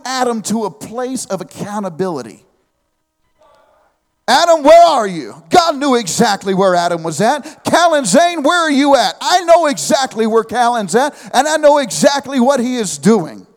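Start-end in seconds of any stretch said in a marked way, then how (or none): 4.3-5.4 loud, high call across the garden; calling out to Adam. Acted. God calling to hiding Adam, loud call. 7.6-9.2 Callan Zane called high and loud; full-name call. Retold or imitated. Dad calling his son by full name, loud call.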